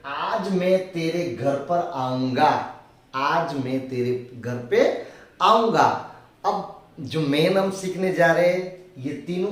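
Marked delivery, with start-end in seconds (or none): 0.1-6.1 slow dictation. Shown as a demonstration. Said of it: "फिर हिंदी में क्या बोलेंगे?".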